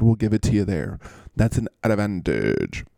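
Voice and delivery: ordinary delivery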